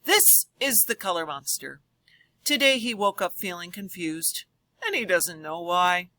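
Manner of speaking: lively storybook voice for a class; reading to children. Acted. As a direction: read it aloud to the kids with big expression. Reads picture book to class.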